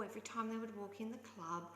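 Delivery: ordinary talk, nothing marked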